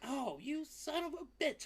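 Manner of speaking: high squeaky teasing cry; high mock-insult to a pet; playful pet squeal. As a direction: squeal it at the cat in mock outrage.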